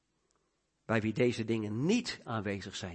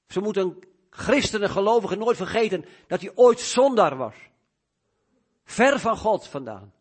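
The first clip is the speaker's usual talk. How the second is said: loud, raised voice driving the exhortation home; impassioned preaching; 8 semitones higher, 12 dB louder than usual. Real, not acted. Preacher urging believers never to forget their past, impassioned preaching. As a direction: preach it high and forcefully.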